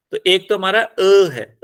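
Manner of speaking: usual speaking voice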